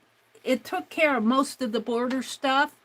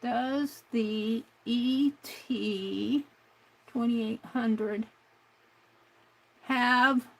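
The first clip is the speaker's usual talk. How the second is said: drawn out word by word; slow, deliberate; much slower than usual. Real, not acted. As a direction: spell it out very slowly. Slowly reading model question.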